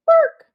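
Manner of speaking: high, loud yappy bark; dog bark. Acted. Dog answers the girl with a high loud bark. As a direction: yap like a small dog.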